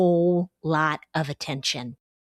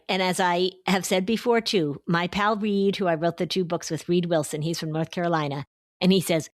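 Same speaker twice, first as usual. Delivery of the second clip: usual speaking voice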